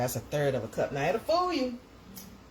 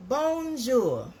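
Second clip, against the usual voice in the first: French greeting; 8 semitones higher, 4 dB louder than usual. Shown as a demonstration. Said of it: "That's my French".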